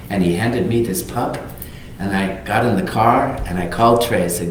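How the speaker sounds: ordinary talk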